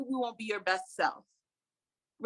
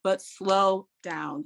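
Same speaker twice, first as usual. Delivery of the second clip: slowed down demo; 3 semitones lower, much slower than usual. Shown as a demonstration. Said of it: "take a breath and engage again".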